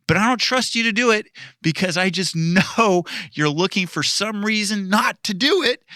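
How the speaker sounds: high, tearful mock outburst; mock anguished rant. Jonah wailing at God, high and teary. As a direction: wail it like a wronged friend.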